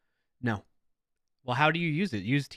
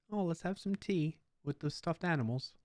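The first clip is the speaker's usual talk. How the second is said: higher, sing-song pretend tea party voice; playing tea with child; 6 semitones higher, 3 dB quieter than usual. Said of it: "pretending, with the child".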